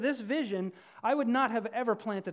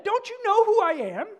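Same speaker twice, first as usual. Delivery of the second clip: loud, high indignant demand; outraged that nobody bows, high and loud; cross; 12 dB louder than usual. A narrator's reading. Snubbed donkey yelling crossly at the well crowd. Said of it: "he said crossly".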